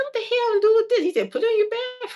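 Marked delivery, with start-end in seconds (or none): 0.0-1.0 very high incredulous question; baffled by the soap set; disbelief. Retold or imitated. Her reaction to soap gift. 1.3-2.1 very high, put-on dad voice; mocking daddy voice. Dad voice answering.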